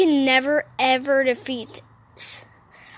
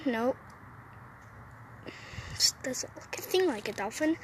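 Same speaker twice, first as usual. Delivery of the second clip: whispering; 12 dB quieter than usual. Real, not acted. Aside muttered while playing.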